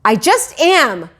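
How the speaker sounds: loud, slow snap; shouted. A narrator's reading. Mother shouts at Peter, loud and slow. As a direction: shout it flatly. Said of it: "my mother shouted".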